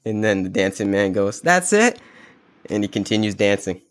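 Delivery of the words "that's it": delighted surprise. Retold or imitated. Dancing man hears five million.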